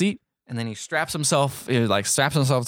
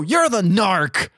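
drawn-out, high accusing cry; mock accusation; 7 semitones higher, 4 dB louder, much slower than usual. Gangster accuses Vin Diesel of informing, mock outrage. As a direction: point the finger, stretching it out high. Acted accusation before a fight.

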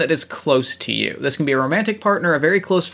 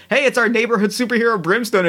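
higher, quicker mock host announcement; podcast host intro; 6 semitones higher, faster than usual. Host introduces superhero guest on check-in segment, playful announcing. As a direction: lightly play an upbeat segment introduction.

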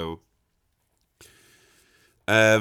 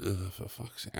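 very low, hushed mock grumble; exasperated aside; 4 semitones lower, 17 dB quieter than usual. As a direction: grumble privately, well under your breath. Private groan at the chatty gardener.

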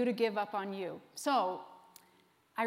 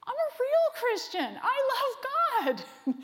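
hurt protest; 10 semitones higher than usual. Retold or imitated. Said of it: "And I thought".

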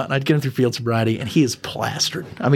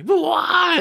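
crying wail. Retold or imitated. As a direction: wail the question through tears. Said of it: "As he's, like, crying".

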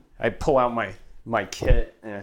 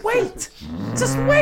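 high-pitched pleading; 4 dB louder, slower than usual. Real, not acted. Hurrying the bird away.

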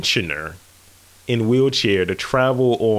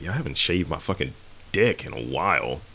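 low muttered aside; 9 semitones lower, 8 dB quieter than usual. Retold or imitated. Retelling his own thought, low.

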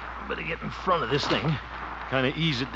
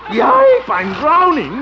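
high, loud yelp of alarm; loud, high panicked cry; 14 dB louder, much slower than usual. Acted. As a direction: cry out as the water hits you. Soaked in washer water.

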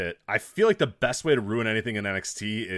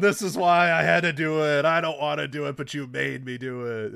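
hammed-up heel wrestler promo that winds down; mock wrestling villain promo, loud then fading; Ciampa promo voice; 5 semitones higher, 4 dB louder than usual. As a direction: snarl it like the villain, then let it sink.

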